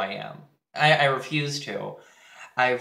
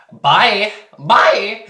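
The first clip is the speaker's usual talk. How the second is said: loud, high dismissive bye; mock loud goodbye; 7 semitones higher, 13 dB louder than usual. Brushing off the classmate with a loud mock farewell. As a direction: shout him away, hammed-up. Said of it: "And I'm like".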